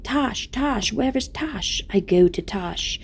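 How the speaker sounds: high calling that slows at the end; imitated trance. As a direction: retell a man chanting as if entranced. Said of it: "rather like a man in a trance".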